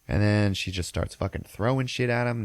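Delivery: ordinary talk, nothing marked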